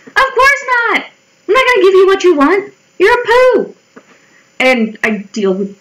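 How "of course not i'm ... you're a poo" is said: high-pitched comic indignation; mock indignant retort. Retort to bullies wanting a reaction, high mock indignation. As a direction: squeal it back in mock scorn.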